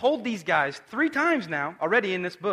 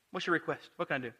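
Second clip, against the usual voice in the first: soft, patient question; gentle; 4 semitones lower, 10 dB quieter, much faster than usual. Retold or imitated. Jesus gently answering the two disciples, soft and patient. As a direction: ask it softly, with all the patience in the world. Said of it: "He's so gentle".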